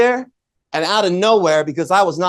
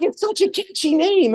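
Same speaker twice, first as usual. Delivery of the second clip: much higher-pitched quoted voice; imitated wife; 11 semitones higher than usual. Wife admiring a speaker's name, imitated in a high voice. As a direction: lift it high to mimic your wife.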